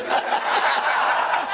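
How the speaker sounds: breathy burst of laughter; a quick laugh. Real, not acted. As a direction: laugh along with the crowd. Preacher laughing at own joke.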